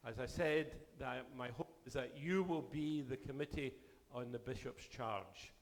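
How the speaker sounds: ordinary delivery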